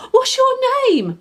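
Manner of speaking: high, loud excited call; eager bark. A narrator's reading. Puppy calls to his reflection, loud and eager. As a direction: shout it out, tail wagging. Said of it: "barked Scamper".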